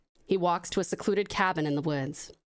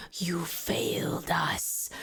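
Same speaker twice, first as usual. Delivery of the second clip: soft, slow whispered chorus; haunting voices; 2 semitones lower, 3 dB quieter, much slower than usual. Acted. Voices in Anaconda's head accusing him, slow whisper. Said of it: "many voices saying".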